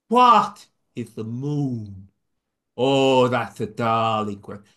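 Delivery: put-on Irish accent. Son acting his dad's Irish quote, slow. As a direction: draw out the question in an Irish lilt. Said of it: "pardon my not very good Irish accent".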